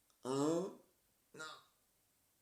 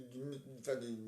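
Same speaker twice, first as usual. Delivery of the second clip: ordinary delivery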